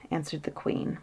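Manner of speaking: usual speaking voice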